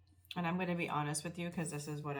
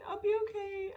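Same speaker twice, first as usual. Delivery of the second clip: high, louder, tight teary reassurance; teary brave voice; 5 dB louder than usual. Retold or imitated. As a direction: push through the tears to sound okay. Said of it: "And I'm like".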